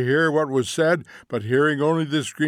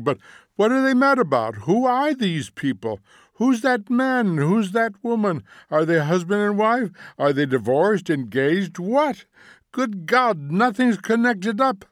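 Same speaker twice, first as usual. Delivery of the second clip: high, raised-voice barrage of questions; exasperated cry; 8 semitones higher, 4 dB louder than usual. A narrator's reading. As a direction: fire off questions, voice climbing in exasperation. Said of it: "cried Montague".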